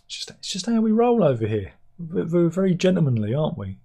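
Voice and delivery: a hint of a mock-posh gentleman; mock British gentleman. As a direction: play up the polite Englishman, tongue in cheek. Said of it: "It's very British".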